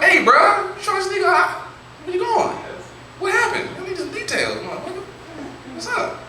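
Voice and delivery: very high, eager calling out; excited high calling. Retold or imitated. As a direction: hustle after him for details. Calling out to homeboy.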